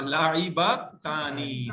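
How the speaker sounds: लाइबातानी drawn out syllable by syllable, stress on ता; slow model word. Shown as a demonstration. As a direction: spell out लाइबातानी slowly for the class. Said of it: "ताब जरूर बढ़ेगी".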